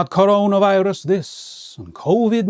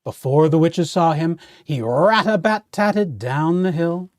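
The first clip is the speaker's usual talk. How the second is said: ordinary delivery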